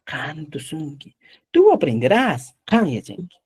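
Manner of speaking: lento, marcando cada frase de ejemplo; modelo de clase. Shown as a demonstration. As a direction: dictar despacio para que el alumno aprenda. (dictado pausado de profesor de quechua)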